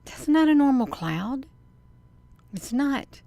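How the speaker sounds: loud, high amazement; real awe at something strange in the sky; astonished. Real, not acted. Astonished at the cloud.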